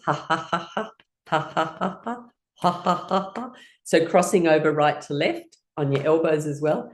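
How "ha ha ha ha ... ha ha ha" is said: light, deliberate ha-ha laugh; laughing sounds. Shown as a demonstration. Teacher leading crossing-over exercise, practised light laughter. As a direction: laugh in neat repeated beats. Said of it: "just making some laughing sounds".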